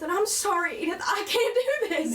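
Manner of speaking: can't finish the grace, breaking. Acted. Mother breaking down over the family grace.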